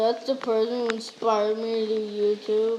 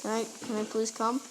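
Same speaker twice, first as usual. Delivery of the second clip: usual speaking voice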